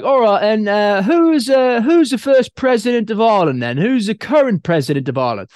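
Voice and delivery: put-on teasing British accent; fake British accent. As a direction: quiz him in a mock English accent. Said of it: "pretending I was English".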